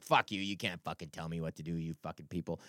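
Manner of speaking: a hint of stubborn muttered defiance; righteous defiance. Comic recalling defying the crowd, low muttered. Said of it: "still righteously being like".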